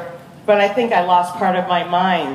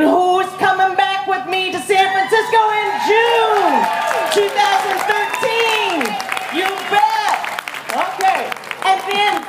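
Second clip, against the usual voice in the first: high, rising crowd call, slowing at the end; rally call; 11 semitones higher than usual. Real, not acted. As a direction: pump up the crowd for June. Calling out to crowd over music.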